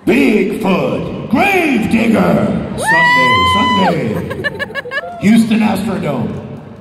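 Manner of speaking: booming high announcer shout; monster truck announcer. Pretend. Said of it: "monster truck commercial".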